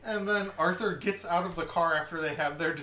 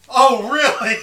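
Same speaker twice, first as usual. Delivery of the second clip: higher, much louder exclamation of interest; mock surprise; 6 semitones higher, 12 dB louder, slower than usual. Someone answers the self-absorbed speaker, in mock surprise. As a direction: pipe up loudly, acting suddenly intrigued. Mock intrigued reply in a retold exchange.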